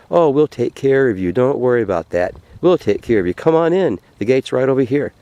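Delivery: high, eager, over-friendly welcome; hammed-up salesman-like coaxing; welcoming gatekeeper. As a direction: beckon him in with big reassurance. Gatekeeper eagerly beckons the man in.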